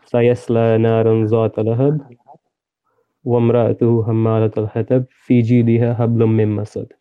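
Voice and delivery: quick, even recital from memory; Arabic recitation. Shown as a demonstration. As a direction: rattle off the memorized Arabic verses. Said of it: "can you read it in Arabic for us".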